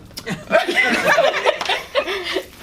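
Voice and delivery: a loud high burst of laughter. Real, not acted. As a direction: laugh out loud mid-story. Laughs while retelling the call.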